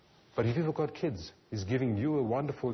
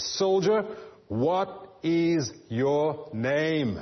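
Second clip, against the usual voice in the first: Soldier barked high and loud, then very slow; a furious emperor spelling out each word; fierce demand; 6 semitones higher, 4 dB louder, much slower than usual. A narrator's reading. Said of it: "with a fierce look and says".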